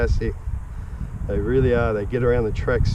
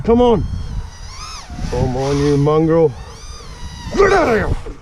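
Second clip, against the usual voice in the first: urgent, scrambled shouts while flying; panicking gamer; 2 semitones higher than usual. Real, not acted. Racing drone, yelling at it.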